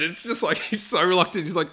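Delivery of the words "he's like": normal talking voice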